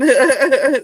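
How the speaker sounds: loud, high burst of giggles; quick bubbly laugh. Real, not acted. Laughs at her own refusal.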